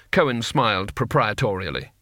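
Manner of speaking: usual speaking voice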